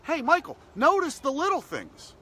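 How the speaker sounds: rally yourself in the mirror; self pep talk. Pretend. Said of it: "look at myself and say".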